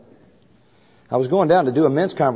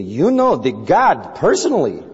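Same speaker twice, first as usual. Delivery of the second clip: stunned disbelief; 5 semitones higher, 3 dB louder, slower than usual. Retold or imitated. Man stunned by the claim.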